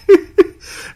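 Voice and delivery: very high, loud laughing squeal. Real, not acted. Laughing while telling memory.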